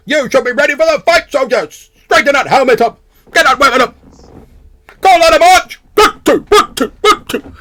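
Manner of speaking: high-pitched, loud drill sergeant; parade-ground shouting. Acted. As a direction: shout marching orders at the troops. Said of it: "shouting in, like, this almost, like, parade ground fashion".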